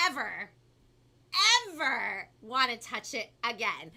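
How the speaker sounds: animated storybook reading with punched key words; lively, theatrical story narration; picture book read-aloud. A narrator's reading. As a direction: read it to children with big swoops of pitch. Reads picture book to children.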